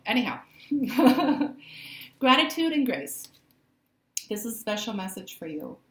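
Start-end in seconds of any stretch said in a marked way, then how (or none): 0.4-2.1 burst of laughter. Real, not acted. Laughs after teasing remark.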